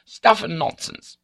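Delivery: high, loud indignant dismissal; angry outburst. A narrator's reading. Accused baronet rejecting the charge, angry. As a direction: snap it out in outrage.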